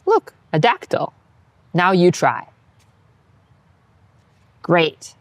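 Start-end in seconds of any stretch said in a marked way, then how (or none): none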